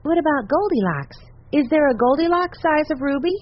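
put-on high-pitched child's question; curious child. A narrator's reading. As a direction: ask it high, like an eager kid. Child's eager question in story.

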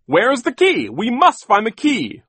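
loud, high, urgent demand; frantic shout. A narrator's reading. Mister Poe needs the gate key, shouting frantically. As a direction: cry out in frantic need.

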